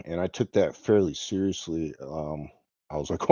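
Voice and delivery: ordinary talk, nothing marked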